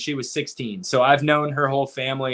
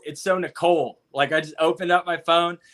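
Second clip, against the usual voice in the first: ordinary talk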